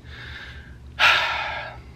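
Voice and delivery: long, breathy exhale; heavy sigh. Real, not acted. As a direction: let out a long weary sigh. Pause before hard part of story.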